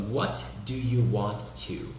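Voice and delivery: "want to" as two clear words, nothing linked; over-clear teacher's "What do you want to"; classroom English. Shown as a demonstration. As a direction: pronounce each word of "What do you want to" fully. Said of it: "Isso é bem inglês da aula, que é muito claro".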